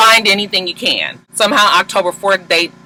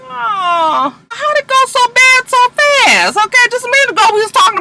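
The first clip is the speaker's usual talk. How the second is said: a high, loud "oh" of shock; stunned disbelief; 4 dB louder than usual. Real, not acted. The recapper reacts to the quarrel, shocked and squealing high. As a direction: squeal in disbelief at the sudden turn. Viewer stunned by sudden fight.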